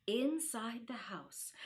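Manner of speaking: usual speaking voice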